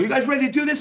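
high, loud hype call; director hyping. Retold or imitated. Tarantino hyping the crew before the take, high and loud. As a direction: pump up the crew.

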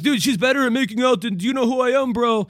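a hint of a swaggering dude butting in; cocky bro. Joking about a fighter crashing the threesome, mock bro voice. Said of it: "Edmund would come in and be like".

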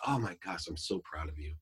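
soft, low, tender praise; hushed proud father; compassionate. Retold or imitated. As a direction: speak softly, full of relief and pride. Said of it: "out of compassion".

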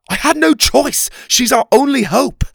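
very high, loud protest; frantic, soaring outcry; desperate shout. A narrator's reading. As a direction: shout back, pleading your case. Oliver shouts back at furious king.

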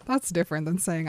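ordinary talk, nothing marked

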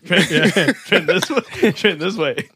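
calling across a room, laughing. Pretend. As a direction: call him over, guiding him. Calling out to blind busboy.

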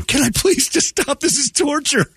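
very high, hammed-up begging; high-pitched fake torment; mock desperate pleading. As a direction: squeal for mercy like a comic victim. Acts out begging to escape algebra.

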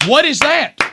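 baffled. Real, not acted. Baffled by the dog breed.

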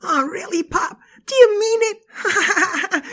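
high, tearful with joy; squealing happy little boy; overjoyed kid. Acted. Overjoyed child.

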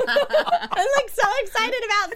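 high, loud and laughing; excited laughing. Real, not acted. Excited about Seed Sheet.

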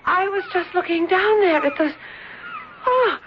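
high, faltering, near tears with stammers; shaken and tearful. Acted. Niece telling the friend about her near fall, tearful and shaken. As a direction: stumble through it, still frightened.

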